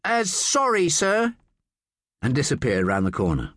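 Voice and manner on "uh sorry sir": high-pitched calling apology; hasty apology tossed back; hurried call. A narrator's reading. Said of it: "called hurriedly".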